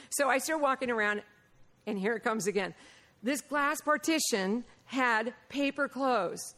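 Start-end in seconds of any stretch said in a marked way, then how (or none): none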